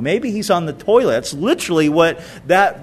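ordinary talk

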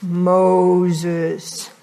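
low, long-drawn solemn call; deep drawn-out divine call; voice from the burning bush. Acted. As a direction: call the name slowly and low, like a summoning voice. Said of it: "he hears this voice and says".

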